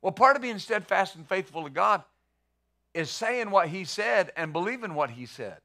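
ordinary talk, nothing marked

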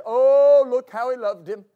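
long loud "oh", then back down; exclaiming onlookers. Acted. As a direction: exclaim in admiring wonder as onlookers.

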